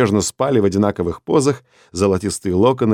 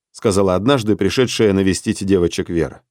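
ordinary delivery

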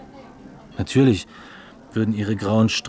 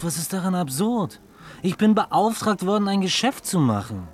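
indignant; 7 semitones higher than usual. Acted. Heated argument over the offer.

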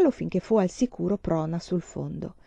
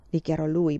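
ordinary delivery

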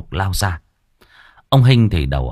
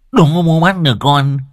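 higher, louder mocking taunt; 7 semitones higher, 5 dB louder than usual. A narrator's reading. Son taunting father as he runs away. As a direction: dare him to catch you. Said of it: "trêu tức".

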